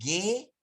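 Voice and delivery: "Ge" with hard G and no W glide; high, loud, rising ge with silent U. Shown as a demonstration. Teacher correcting for the class, demonstrating. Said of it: "no es we".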